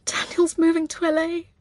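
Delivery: high, crying wail; distraught, pitch way up; tearful breakdown. A narrator's reading. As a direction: force the news out through tears.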